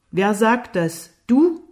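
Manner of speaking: loud questions jumping up in pitch; scornful challenge. A narrator's reading. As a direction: challenge him, incredulous and angry. Jimmy fordert Lukas höhnisch heraus. (herausfordernd und höhnisch)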